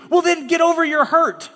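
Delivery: high, mocking get over it; a mock pastor telling Joe to move on; dismissive retort. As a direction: brush the hurt aside with a sneer. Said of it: "mock retort to Joe".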